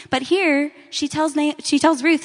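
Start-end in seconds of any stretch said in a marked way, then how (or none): none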